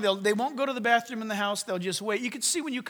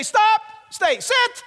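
loud high commands; commanding; 10 semitones higher, 4 dB louder, much slower than usual. Pretend. Preacher voicing God giving firm commands, loud. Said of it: "He might say".

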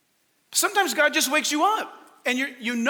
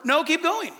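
usual speaking voice

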